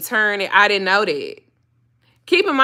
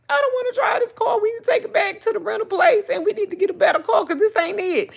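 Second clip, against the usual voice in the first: high, fast whiny protest; whiny complaint; 12 semitones higher, faster than usual. Retold or imitated. Wife pleading with husband, high fast whine. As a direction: whine to return the rental car. Her own whiny quote.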